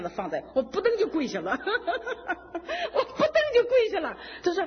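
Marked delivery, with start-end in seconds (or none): 1.6-4.2 high, slow, laughing and teary; laughing through tears. Real, not acted. Kneeling story told laughing through tears. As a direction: break into tearful laughter.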